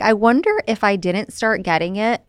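usual speaking voice

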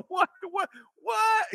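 very high, laughing cry of what; laughing disbelief. Real, not acted. Host laughs at the convict's outburst in high disbelief. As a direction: squeal out the disbelief.

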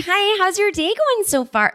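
high, mock-friendly small talk; fake chipper greeting. Mocking LA small talk to strangers, fake chipper. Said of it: "I'd rather that than, like".

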